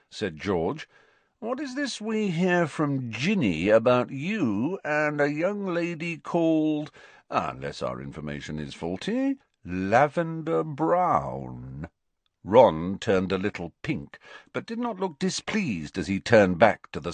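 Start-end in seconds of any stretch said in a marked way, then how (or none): 1.4-11.9 teasing. Acted. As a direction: tease him, drag the name. George teasing Ron.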